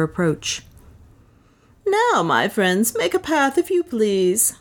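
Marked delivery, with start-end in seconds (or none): 1.9-4.5 raised higher Leonard voice, slow; Leonard loud. A narrator's reading. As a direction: call out to the crowd as Leonard, unhurried. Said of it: "said Leonard in a loud voice".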